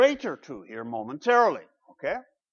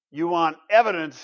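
ordinary delivery

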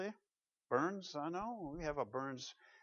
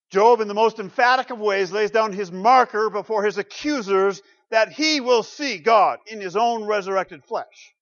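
loud, ringing pulpit proclamation; preaching at full force; 7 semitones higher, 16 dB louder than usual. Acted. Preacher to congregation, loud ringing proclamation. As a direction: proclaim it to the rafters.

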